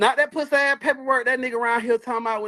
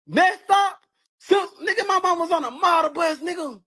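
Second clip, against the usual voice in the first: real angry shout; 5 semitones higher than usual. Real, not acted. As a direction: shout it out, still seething. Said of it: "Why I'm mad?".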